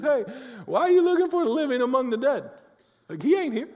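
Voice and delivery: a laid-back angel poking fun at the mourners; playful angel voice. Retold or imitated. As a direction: toss it off like a cheeky angel. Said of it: "and the angel says".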